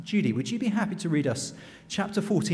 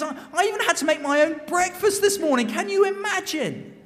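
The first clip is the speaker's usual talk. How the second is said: very high, scandalised voice; hammed-up indignation, pitch held high; outraged complaint; 13 semitones higher than usual. Second official outraged at chores.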